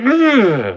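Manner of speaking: mock disgusted ugh, high and loud; a stiff parent recoiling from a hug. Stiff parent pushing off a hugging kid, disgusted. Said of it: "And they're like".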